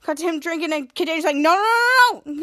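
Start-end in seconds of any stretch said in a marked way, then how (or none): 1.3-2.2 raised, loud run of cries; dismayed protest. Retold or imitated. Kid Danger watching Toddler drink, loud higher protest. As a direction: cry out to stop it, acting.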